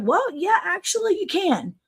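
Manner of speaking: lightly voiced old man, pitched high; a hint of an old gentleman; old man impression. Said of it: "Now, this old man".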